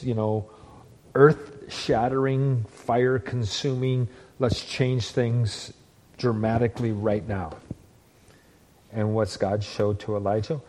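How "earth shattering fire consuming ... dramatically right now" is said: drawn-out words. Acted. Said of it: "preacher drawing out dramatic words".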